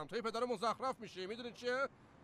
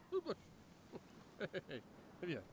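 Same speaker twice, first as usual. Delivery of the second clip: hushed call; 6 dB quieter, much slower than usual. Acted. Calling the dog again, hushed.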